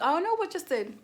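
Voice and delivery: high-pitched mock innocence; playing dumb. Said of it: "This girl acts dumb".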